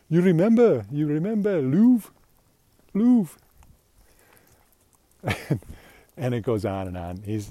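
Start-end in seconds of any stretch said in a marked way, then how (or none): 0.1-3.4 put-on high, loud, insistent French accent; imitated French guy. French guy pushing the CEO to remember, loud mock French accent. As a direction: jog his memory in an exaggerated French accent. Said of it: "French guy says".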